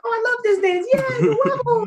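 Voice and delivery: put-on high, gushing little kid; excited kid voice. Kids in the crowd gushing over the dance, high excited child voice. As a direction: squeal it like an excited child fan.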